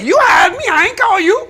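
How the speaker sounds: preacher shouting at a church that won't pay; indignant shout. Real, not acted. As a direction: fling it back at them. Pastor rebukes church that won't pay.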